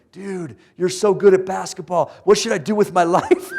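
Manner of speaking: high, loud gushing fan; mock adoration. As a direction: gush absurdly, as if begging a guru. Mock fan addressing athletic friend.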